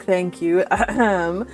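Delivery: ordinary talk, nothing marked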